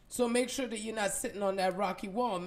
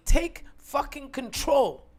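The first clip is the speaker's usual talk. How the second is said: forceful command; 4 semitones higher, 6 dB louder, slower than usual. Acted. Self-talk motivational push.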